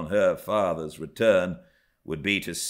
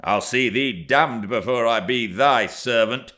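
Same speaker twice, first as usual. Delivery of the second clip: louder, defiant lad's retort; angry growl; 5 semitones higher, 6 dB louder than usual. A narrator's reading. Hareton refusing to be her servant, loud angry growl. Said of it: "growled the lad".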